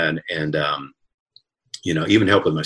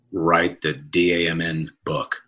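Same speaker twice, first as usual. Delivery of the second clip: spelled-out swear; slower than usual. Retold or imitated.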